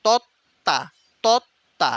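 তত্ত্বা broken as "tot... ta"; doubled t. Shown as a demonstration. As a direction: stop on the t in তত্ত্বা, then "ta". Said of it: "তিনটা তয়ের উচ্চারণ কিন্তু আসতেছে". (তত্ত্বা ভেঙে "তৎ... তা")

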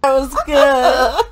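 slow laughing praise; praising the scene, slow laughing. Real, not acted. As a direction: laugh through the words, delighted.

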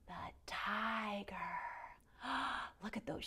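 hushed reveal whisper. Acted. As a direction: whisper the name like a secret. Kids yoga story, hushed reveal.

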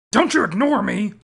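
normal talking voice

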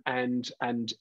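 normal talking voice

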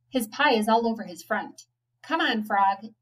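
ordinary delivery, nothing marked

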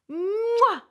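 playful mwah kiss; blown kiss. Acted. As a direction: blow a big kiss.

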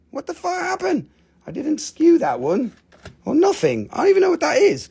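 surprised. Real, not acted. Confused by skipped item.

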